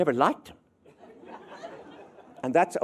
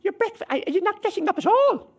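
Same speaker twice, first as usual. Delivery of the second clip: mother shouting; 6 dB louder than usual. Retold or imitated. Mother calls upstairs to hungover child, shouting. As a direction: yell up the stairs like an Irish mother. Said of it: "your mother shouts out".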